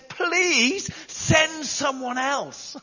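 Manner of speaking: high, very slow, drawn-out plea; pleading Moses. Retold or imitated. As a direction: beg slowly, as if hoping to be let off. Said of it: "Moses pleads with God and says".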